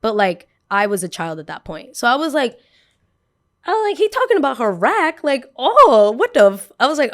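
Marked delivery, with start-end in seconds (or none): 3.7-6.7 high, loud shocked squeal; shocked outburst. Retold or imitated. Young girl hearing a boy talk about a classmate's body, shocked high loud squeal. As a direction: gasp it out in disbelief. Kid shocked at boy's comment.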